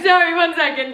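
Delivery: high giggling apology; laughing. Real, not acted. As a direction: giggle through the words. Cracking up at friend.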